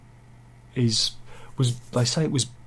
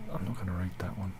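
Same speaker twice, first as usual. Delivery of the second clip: muttered under breath; 5 semitones lower, 14 dB quieter, faster than usual. Real, not acted. Muttered refusal.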